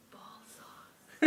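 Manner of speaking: breathy stage whisper. Real, not acted. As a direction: whisper it like a secret. Whispered punchline, then laughs.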